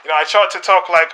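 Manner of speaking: ordinary delivery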